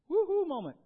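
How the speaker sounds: high, loud woohoo; excited whoop. Acted. Pastor cheering the cancelled debt, excited whoop. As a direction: celebrate it like good news.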